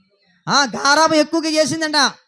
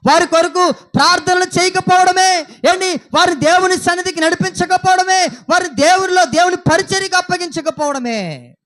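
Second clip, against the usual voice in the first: loud, raised preacher's cry; thundering sermon voice, pitched up; fiery preaching; 4 semitones higher, 4 dB louder than usual. Acted. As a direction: drive every line home to the congregation. Preaching at full force.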